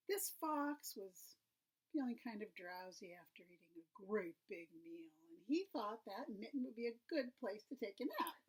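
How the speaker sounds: plain reading voice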